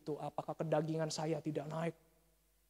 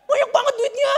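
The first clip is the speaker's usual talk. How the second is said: very high, very loud mock shriek; hammed-up astonishment; 21 dB louder than usual. Preacher acting out opening a suitcase of money, hammed-up shock. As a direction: yell it as if gawking at piles of cash.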